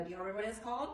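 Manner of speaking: normal talking voice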